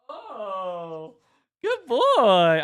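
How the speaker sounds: high, loud praise for the dog; excited praise. Real, not acted. Praising the dog as he jumps up, high and loud.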